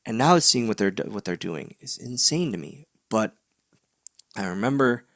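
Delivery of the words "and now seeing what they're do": ordinary delivery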